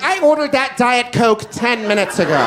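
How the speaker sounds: high, indignant complaining customer; angry restaurant lady. Retold or imitated. Angry lady complaining to restaurant staff. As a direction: demand the manager, outraged. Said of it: "Like I'm an angry lady at a restaurant".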